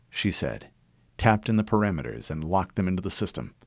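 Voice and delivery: plain reading voice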